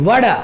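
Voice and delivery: "ওয়াডা" with a d for the t; American "ওয়াডা", final r left silent; American pronunciation. Shown as a demonstration. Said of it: "American উচ্চারণ হচ্ছে টিটাকে ডি উচ্চারণ করে".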